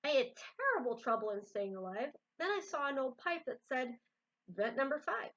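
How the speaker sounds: lively read-aloud voice for a picture book; story narration. A narrator's reading. Reader to viewers, reading a Seuss book aloud. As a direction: tell the rhyme with bounce.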